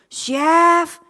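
loud, long call of the name; shout. Retold or imitated. Hostess finally shouts for the chef, loud. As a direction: shout it across the kitchen. Chef langsung lihat ke saya.

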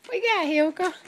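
high-pitched dog-talk question; excited pet talk. Real, not acted. Owner asking dog what it got, excited.

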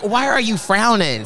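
high, drawn-out mock reproach; teasing telling-off, stretched out; playful scolding. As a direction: chide them playfully, pitch lifted. Acted scolding of frowning runners.

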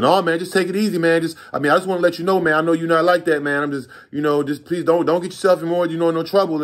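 pleading buyer. Retold or imitated. Buyer begging the dealer not to cause trouble, high and nervous. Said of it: "He's like".

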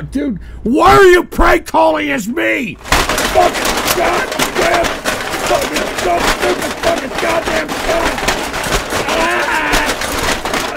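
high, loud screaming rage; furious high-pitched tirade; enraged outburst. Real, not acted. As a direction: explode at a prank caller, stretching the words. Host enraged at prank calls.

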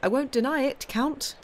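high, merry admission; merrily. A narrator's reading. Morrel admitting his love to the Count, merry and high. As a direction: cheerfully own up to it. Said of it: "Morrel said merrily".

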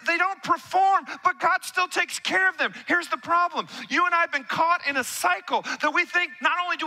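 high, driving preacher voice; fervent sermon peak; full-force preaching. Acted. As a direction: preach it hard to the room.

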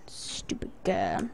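slow whispered insult; breathy hushed put-down; whispering. Real, not acted. Muttered mock insult to character.

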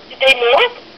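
ordinary delivery

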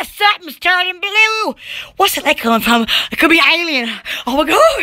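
normal talking voice

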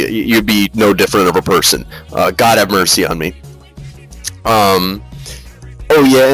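usual speaking voice

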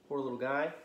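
ordinary delivery

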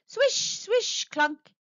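high-pitched swishing washer words; washing machine noises. A narrator's reading. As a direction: act out the machine's swish and clunk. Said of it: "it went".

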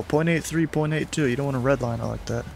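ordinary talk, nothing marked

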